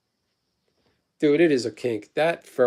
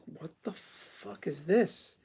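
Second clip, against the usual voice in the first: stunned hush; 4 semitones higher, 11 dB quieter, much slower than usual. Retold or imitated. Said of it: "and I'm like".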